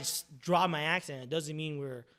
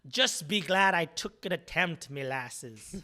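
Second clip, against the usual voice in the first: slow Irish brogue that sounds Scottish; attempted Irish accent; 4 dB louder, much slower than usual. Improviser joking to scene partner about an attempted accent, shaky Irish-Scottish accent. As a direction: put on a loud, slow, muddled Irish accent. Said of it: "Um, Scottish, right?".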